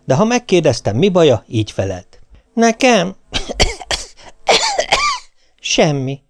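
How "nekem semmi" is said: high voice broken by a cough; ailing wife. A narrator's reading. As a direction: act the martyr, coughing mid-answer. (magas, köhécselő, szenvedő feleséghang)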